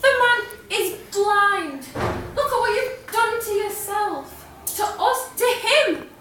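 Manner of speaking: Patricia. Acted. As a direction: build the accusation, hitting key words hard. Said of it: "I'll be performing in the character of Patricia".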